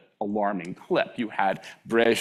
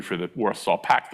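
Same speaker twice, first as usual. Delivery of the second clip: ordinary talk, nothing marked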